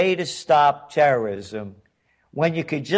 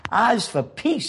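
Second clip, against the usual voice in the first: put-on Southern accent; 7 semitones higher than usual. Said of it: "I have to do that in a Southern accent".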